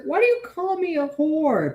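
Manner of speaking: a hammed-up mock of the woman she's quoting; mocking whiny woman. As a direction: whine it like she's the victim. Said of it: "it's like".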